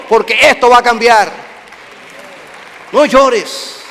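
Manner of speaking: loud, high, driving sermon climax; preaching at full force. Real, not acted. Pastor proclaiming Jesus' power over death, shouting. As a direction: preach it out to the congregation.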